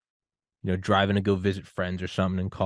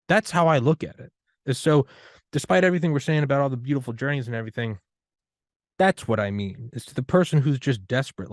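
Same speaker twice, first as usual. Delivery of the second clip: ordinary talk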